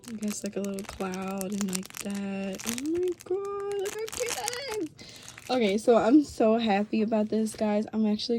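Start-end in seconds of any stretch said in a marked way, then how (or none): 2.7-5.5 very high, drawn-out tiny squeal; cuteness squeal. Real, not acted. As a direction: squeal it thinly, melting at the cuteness. Squealing over cute doll accessory.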